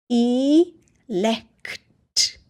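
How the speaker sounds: long E, a pause, then lekt; split syllables. Shown as a demonstration. Teacher sounding out a new word, syllables apart. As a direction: sound out E and lekt as two separate pieces. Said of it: "Say it a little faster".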